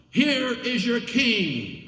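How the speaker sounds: high, slow proclamation; mocking presentation. A narrator's reading. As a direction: present him with scorn. Pilate presents Jesus.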